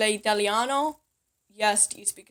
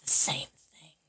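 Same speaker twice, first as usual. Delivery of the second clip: whispered aside; 6 semitones lower than usual. Real, not acted. Dropping to a whisper.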